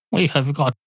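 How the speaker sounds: usual speaking voice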